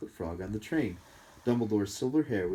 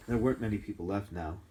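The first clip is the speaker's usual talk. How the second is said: usual speaking voice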